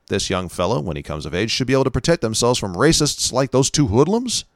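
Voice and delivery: ordinary talk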